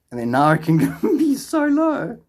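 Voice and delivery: high, slow, breaking into laughter; laughing in disbelief. Real, not acted. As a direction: laugh through it as pitch climbs. Laughs at how low earnings are.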